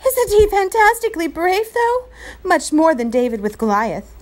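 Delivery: put-on high, loud thrilled boy; excited. A narrator's reading. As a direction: gush with delight. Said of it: "danced with excitement".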